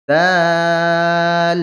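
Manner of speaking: দাল held very long and loud; three alif stretch. Shown as a demonstration. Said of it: "তিন আলিফ টান দিয়ে পড়তে হবে".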